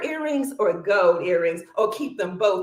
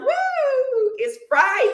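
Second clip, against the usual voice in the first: excited whoop; 12 semitones higher, slower than usual. Real, not acted. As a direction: cheer it out.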